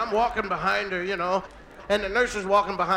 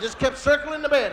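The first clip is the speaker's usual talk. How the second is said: ordinary talk, nothing marked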